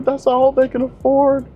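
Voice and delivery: high falsetto whiny plea; mock crying agent. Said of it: "whole big sob story".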